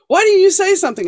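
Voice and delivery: high, loud indignant demand; upset accusation. Retold or imitated. Stepdaughter confronting her stepmother, high, loud and indignant. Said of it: "And she's like".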